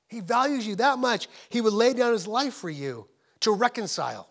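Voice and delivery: big preaching delivery with stretched emphatic words; full-force preaching. Real, not acted. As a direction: drive it out to the congregation, hammering key words.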